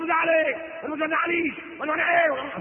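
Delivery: loud, high mock yelling; hammed-up quarrelsome retort, pitched high; wife shouting back. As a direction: yell back like an indignant wife. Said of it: "إنما بقى أنت بتزعق".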